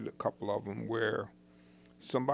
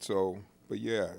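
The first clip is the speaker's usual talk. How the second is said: ordinary talk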